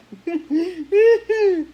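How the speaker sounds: sudden high loud laugh; loud burst of laughter. Real, not acted. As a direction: let out a big surprised laugh. Laughs at own face.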